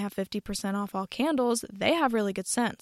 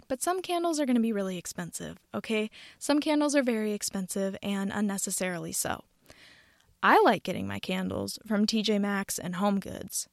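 ordinary talk, nothing marked

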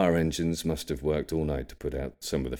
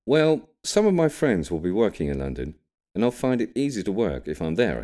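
put-on higher, louder young man's voice; young William; 6 semitones higher, 6 dB louder than usual. A narrator's reading. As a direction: voice the grandson, bright and eager. William's voice in story.

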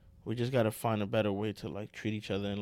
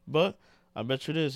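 usual speaking voice

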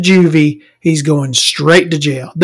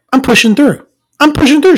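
firm announcement; 8 semitones higher, faster than usual. Retold or imitated. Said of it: "I'm like".

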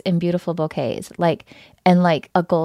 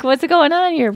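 much higher, incredulous squeak; mock bewilderment; 10 semitones higher, 4 dB louder than usual. Wife baffled when Jim drops French, high incredulous. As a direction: act baffled at the switch to English. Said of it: "I was like".

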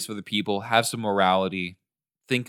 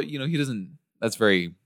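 ordinary delivery

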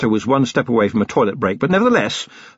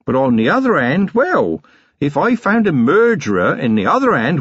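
a hint of a mock-genial commander; knowing copper; 8 semitones higher than usual. A narrator's reading. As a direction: lean on him with sly menace. Vimes menaces suspect.

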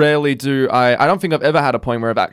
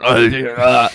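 louder, garbled caricature; imitating crackhead lady; 5 dB louder than usual. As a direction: slur it like the addled woman. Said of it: "She was still going".

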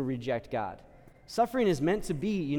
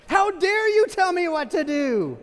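much higher, much louder, slow indignant yell; mock outrage; 14 semitones higher, 8 dB louder, slower than usual. Believer yelling at the priest, full mock outrage. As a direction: explode at being told to confess. Acting outrage at confession advice.

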